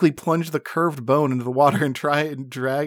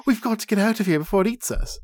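higher, urgent frightened voice; panicky halfling; 8 semitones higher, faster than usual. Acted. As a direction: plead to get out fast. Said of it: "cries the halfling".

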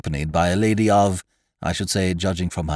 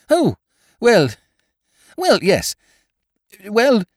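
high, floundering toff; 7 dB louder, slower than usual. Acted. Wooster stalling before the schoolgirls, floundering. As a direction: stall for time, flustered. Painful to see his brain working.

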